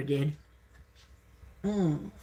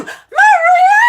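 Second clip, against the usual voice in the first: screamed name, much higher and louder; furious yell; 7 dB louder than usual. Real, not acted. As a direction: scream her name in pure rage. Shouting at Maria during rant.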